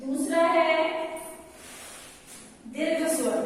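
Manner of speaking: usual speaking voice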